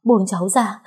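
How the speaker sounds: tearful plea while struggling; begging to be let go; pleading struggle. Acted. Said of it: "cố gắng vùng vẫy để thoát khỏi bọn họ".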